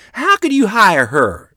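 loud, high, quarrelling mother; outraged outburst. A narrator's reading. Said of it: "Mother's voice tumbled out of her mouth".

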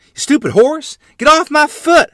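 high, loud angry yell. A narrator's reading. Child with a smashed foot yells at the horse. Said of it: "I yelled".

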